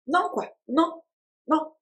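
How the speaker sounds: high, desperate pleading no; panicked no. Retold or imitated. Mother refusing emergency caesarean in the delivery room, high panicked protest. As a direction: relive the panic as you refuse.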